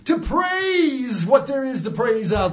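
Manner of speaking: high, shouting revival preacher; mock preacher working up the crowd; preaching.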